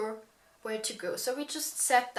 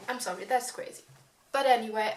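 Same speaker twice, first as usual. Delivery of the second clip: ordinary talk, nothing marked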